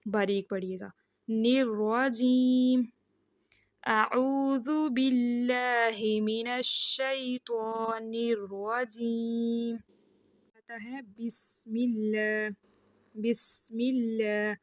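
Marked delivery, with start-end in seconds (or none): 1.5-9.8 الرجیم with a thick ر, very slow; heavy ra recitation. Shown as a demonstration. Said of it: "موٹی کر کے پڑھی جاتی ہے". 11.2-14.5 بسم with a light clear س, not ص; slow بسم اللہ, thin س; clear seen. Shown as a demonstration. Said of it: "سین کو کلیئر ادا کریں".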